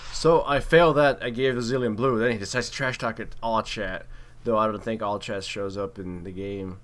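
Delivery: ordinary delivery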